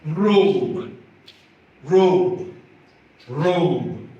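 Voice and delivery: robe said three times with a long rounded "oh"; word demo. Shown as a demonstration. Teacher modelling robe for students. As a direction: sound out robe clearly. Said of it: "we have".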